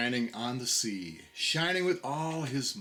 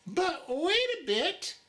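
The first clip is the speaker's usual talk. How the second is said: much higher, small anxious voice; anxious little oysters; 14 semitones higher than usual. A narrator's reading. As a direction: squeak it like a worried little oyster. The oysters cried.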